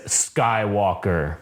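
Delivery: drawn-out, low Skywalker; slow Skywalker. Retold or imitated. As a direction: stretch the name slowly. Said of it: "he says".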